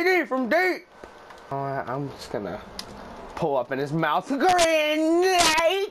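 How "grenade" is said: high shouted call; excited shout. Real, not acted. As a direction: cry it out as the shot flies.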